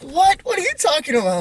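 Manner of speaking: loud, very high-pitched imitation of a brother; squeaky mock-panicked outburst; frantic confusion. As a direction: act out a kid yelping in bewilderment. Voices his frantic, confused brother.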